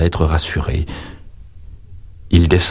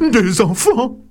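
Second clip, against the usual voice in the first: high panicked ogre cry; a timid ogre crying out in fear at the children; frightened cry; slower than usual. A narrator's reading. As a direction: shriek it like a giant terrified of kids. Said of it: "L'ogre s'écrie".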